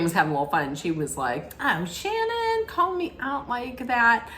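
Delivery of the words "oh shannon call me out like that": teasing Luann. Retold or imitated.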